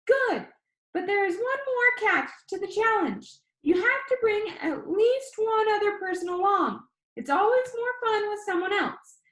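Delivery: high, bright-voiced video host; upbeat YouTuber. A narrator's reading. YouTuber Danny asks the viewer, upbeat and high. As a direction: pitch it up like an eager vlogger.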